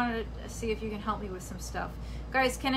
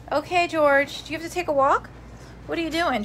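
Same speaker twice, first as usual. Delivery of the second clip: high, loud coaxing talk to the dog; higher, louder pet-talk voice for George; 5 semitones higher, 4 dB louder than usual. Real, not acted. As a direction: sing it up to your dog. Talking to her dog.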